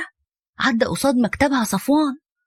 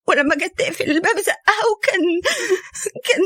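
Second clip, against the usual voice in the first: higher, faster, tearful begging; crying plea; 7 semitones higher than usual. Acted. Ruqaya begging her aunt to come home, crying and higher. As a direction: sob out the plea, words tumbling. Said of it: "وهي بتبكي".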